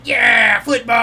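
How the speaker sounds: put-on high, loud child's cheer; toddler cheering. Daughter cheering along with dad, high and loud. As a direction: shout it like a thrilled toddler. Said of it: "she's like".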